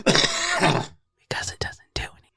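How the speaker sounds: breathy whispered aside; slow hushed confiding aside. Real, not acted. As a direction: whisper it slowly, conspiratorial.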